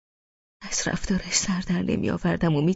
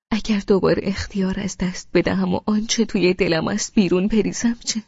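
usual speaking voice

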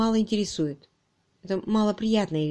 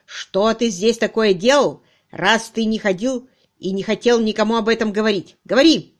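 loud, insistent grilling building to a barked order; stern boss demanding answers; demanding interrogation; 2 semitones higher, 7 dB louder than usual. Acted. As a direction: press the suspect hard. Angry interrogation by chief.

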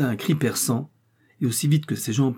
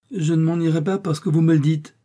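high, emphatic refusal; defiant exclamation; 7 semitones higher, 4 dB louder than usual. A narrator's reading. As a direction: exclaim it defiantly at a raised pitch. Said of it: "s'écria Betty". (protestation vive, voix haut perchée)

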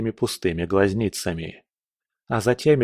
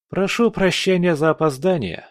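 higher, light apology; playful nonchalance; 8 semitones higher than usual. A narrator's reading. Commander arrives late to battle, breezy. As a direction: toss it off like a wink. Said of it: "Теодор подмигнул ему".

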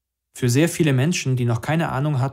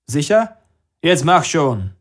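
calling through door; 5 semitones higher, 7 dB louder, slower than usual. A narrator's reading. Exchange shouted through closed door.

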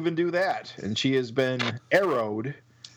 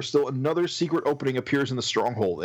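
ordinary delivery, nothing marked

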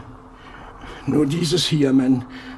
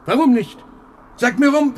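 higher, louder, demanding why; rising agitation; 7 semitones higher, 4 dB louder than usual. Acted. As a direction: start to lose control. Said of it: "Du bist außer dir".

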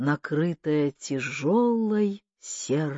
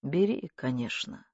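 soft reply, falling away; subdued, weary consent; tired, embarrassed; 4 semitones lower, 6 dB quieter than usual. A narrator's reading. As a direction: give in wearily and quietly. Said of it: "устал и смущён этим разговором".

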